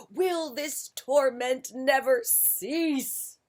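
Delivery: slow loud anguished bear wail. A narrator's reading. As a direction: drag it out in despair. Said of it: "wailed Bear".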